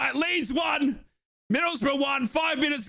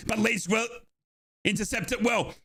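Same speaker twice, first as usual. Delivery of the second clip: ordinary talk, nothing marked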